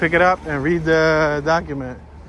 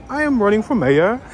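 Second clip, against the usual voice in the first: mock British candidate, pitched up; British accent; 6 semitones higher than usual. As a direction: put on a British accent to imitate her. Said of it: "she has a really strong accent".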